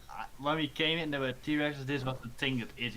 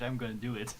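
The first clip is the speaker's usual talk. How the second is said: ordinary delivery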